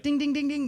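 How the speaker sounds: high sung ding ding; bell imitation. Audience volunteers ringing the bell, high mock chime. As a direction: chime it out like a game-show bell. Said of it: "They're like".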